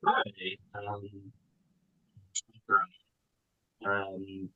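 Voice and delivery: muffled bad mic sound; like talking from underwater. Real, not acted. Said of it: "it sounds like you're underwater".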